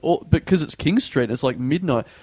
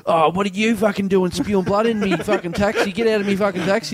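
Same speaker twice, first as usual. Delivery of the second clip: higher, indignant Aussie rant; irate cabbie; 5 semitones higher than usual. Retold or imitated. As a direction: kick him out of the cab. Angry taxi driver voiced.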